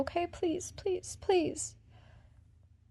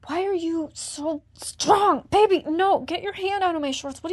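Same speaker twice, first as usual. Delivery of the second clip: distressed protest; 3 semitones higher, 7 dB louder than usual. Acted. Woman struggling against her partner's grip, loud and strained. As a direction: push back with everything, voice swelling.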